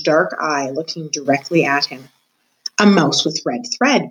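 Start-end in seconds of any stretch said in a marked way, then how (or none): none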